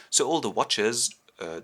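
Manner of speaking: ordinary delivery, nothing marked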